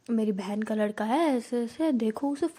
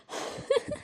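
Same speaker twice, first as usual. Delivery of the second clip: laughing; 8 dB quieter than usual. Retold or imitated. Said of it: "Anjali ma'am हंसते हंसते कहती".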